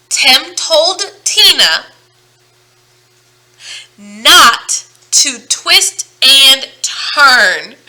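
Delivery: stretched out, each t hit hard and loud; very slow tongue twister. Shown as a demonstration. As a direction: drag it out word by word. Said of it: "Let's try it again".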